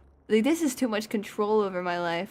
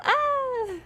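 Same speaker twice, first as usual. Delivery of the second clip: sudden high shriek of fright; real jump-scare shriek; startled scream; 3 dB louder than usual. Real, not acted. Gamer shrieking at a sudden scare.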